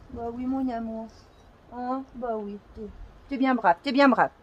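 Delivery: tender. Real, not acted. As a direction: soothe the dog gently. Soothing the dog softly.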